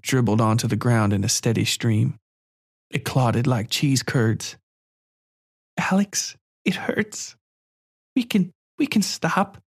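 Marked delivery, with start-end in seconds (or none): 5.8-9.6 put-on high child voice, half whispered in pain; a weak girl pleading to stop; hurting little girl. A narrator's reading.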